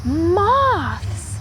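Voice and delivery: high excited little owl cry; bright cry of surprise; cried out. A narrator's reading. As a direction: call it out like a thrilled little owl. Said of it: "Little Owl cried".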